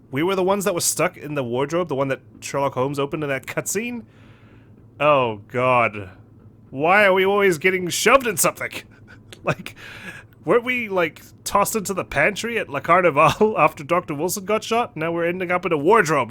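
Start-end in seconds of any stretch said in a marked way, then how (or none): none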